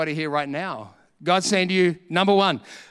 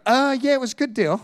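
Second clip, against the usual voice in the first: sheepish dodge; 7 semitones higher than usual. Retold or imitated. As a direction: bluff past the question awkwardly. Dodging the neighbours' question.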